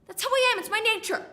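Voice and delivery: high-pitched, insistent self-justification; defensive protest. Acted. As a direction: jump up in pitch to defend yourself. Defends herself mid-rant.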